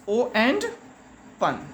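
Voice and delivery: "O and pun" as two parts, pause before pun; split into syllables. Shown as a demonstration. Teacher showing children open has two syllables, "O and pun" said in parts. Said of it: "open has two syllables".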